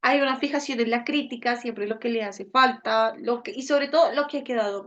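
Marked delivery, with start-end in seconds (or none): none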